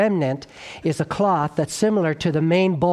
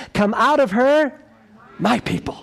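voice from heaven; 7 semitones higher, 3 dB louder than usual. A narrator's reading. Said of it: "another voice from heaven".

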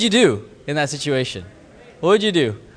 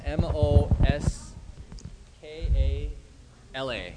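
soft letters, fading away at the end; quiet spelling; 8 dB quieter, slower than usual. Real, not acted. Speaker spells the preacher's name for listeners, quietly. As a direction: slowly spell it out, dropping the volume. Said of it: "Say that again".

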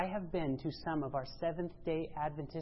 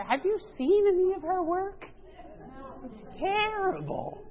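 sarcastic; 12 semitones higher, 4 dB louder than usual. Acted. Preacher mocking critics to his congregation, sarcastic high voice. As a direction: feign horror to mock the critics. Mock outrage at celebrity work.

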